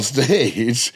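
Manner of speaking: ordinary talk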